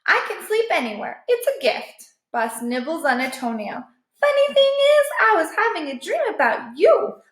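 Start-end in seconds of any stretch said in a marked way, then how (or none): 0.1-2.1 high, quick offhand brag; lightly voiced cocky stray; breezy higher dog voice; Boss voice. A narrator's reading. 4.2-7.2 higher fond dog voice; lightly voiced stray sister; a hint of a high dog voice; Boss voice. A narrator's reading.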